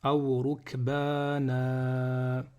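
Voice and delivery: slow "aw rukbana", last a held long; alif at stop. Shown as a demonstration. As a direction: recite "aw rukbana" with no final n. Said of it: "Donc ça fait".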